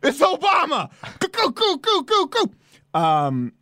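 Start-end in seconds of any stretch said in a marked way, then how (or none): 0.0-2.5 high, squeaky cartoon voice hammed up; cartoonish mock voice. Host mocking Obama on air, in a much higher squeaky cartoon voice.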